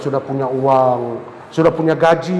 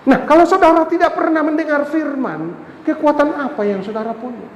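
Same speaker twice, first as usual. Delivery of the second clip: impassioned preaching; 11 semitones higher than usual. Acted. Preaching about Jesus weeping. (khotbah penuh gairah, suara tinggi dan keras)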